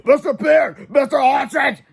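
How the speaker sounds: high, loud shouted names; screamed names. Acted. Old Mister Crump screaming at the teachers across the playground. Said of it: "and screamed".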